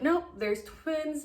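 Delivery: high, drawn-out happy news; slow excited announcement. Retold or imitated. Doctors telling the couple it's twins, slow and excited. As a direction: announce it slowly and brightly.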